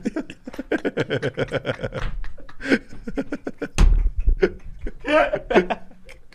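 high real laughter that keeps going; long laughing fit. Real, not acted. Friends laughing at the robber story, long helpless laugh. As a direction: laugh until out of words.

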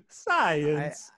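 laughing through an Italian teacher voice; mock Italian teacher, giggling. Shown as a demonstration. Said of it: "very Italian teacher way".

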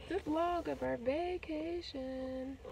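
high, slow title announcement; drawn-out announcing. Real, not acted.

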